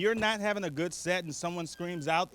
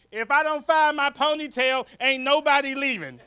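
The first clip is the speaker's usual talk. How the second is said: high mock holler; retold street scream; 6 semitones higher, 4 dB louder than usual. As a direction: imitate a lady yelling on the street, pitched up. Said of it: "some lady just screamed out".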